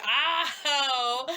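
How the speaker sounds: usual speaking voice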